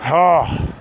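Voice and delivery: ordinary talk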